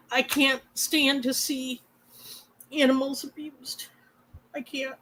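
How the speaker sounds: trailing into a tearful hush; words fading as tears come; holding back tears. Real, not acted. Speaking to viewers about abused animals, tears fading to a hush. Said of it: "I'm not trying to cry".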